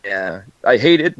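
ordinary talk

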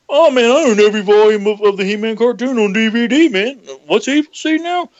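mock a know-it-all He-Man fan. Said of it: "and you own".

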